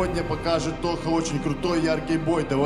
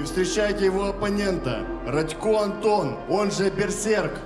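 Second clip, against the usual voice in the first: ring announcer; 4 semitones higher than usual. Acted. Ring announcer introduces fighter.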